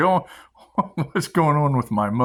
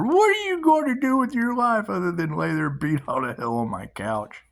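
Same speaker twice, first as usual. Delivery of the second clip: exasperated dad; 7 semitones higher than usual. Retold or imitated. Dad scolding the injured son.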